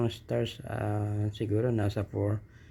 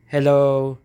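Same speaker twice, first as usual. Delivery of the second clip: louder, higher hello; loud test hello; 5 semitones higher, 10 dB louder than usual. Shown as a demonstration. Testing loudness from mid distance, loud high hello.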